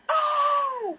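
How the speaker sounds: very high, loud re-enacted scream; sudden piercing shriek; panicked scream. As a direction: scream as if a bug hit your ear.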